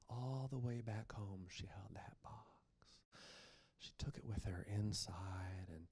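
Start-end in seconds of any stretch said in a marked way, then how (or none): 1.5-5.9 soft, hushed storyteller whisper. A narrator's reading. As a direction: lean in and whisper it.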